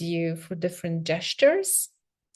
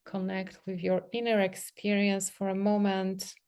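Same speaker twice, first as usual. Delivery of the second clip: soft, unhurried meditation voice with long pauses; guided meditation; 4 dB quieter than usual. Real, not acted. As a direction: gently guide them into sensing the body, leaving space between phrases. Leads a guided self-touch meditation.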